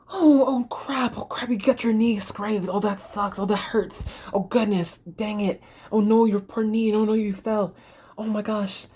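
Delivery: hammed-up high soothing adult; lightly voiced fussing grown-up; sympathetic adult. Adult comforting a kid who scraped a knee, high and fussing. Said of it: "this is up to you to be like".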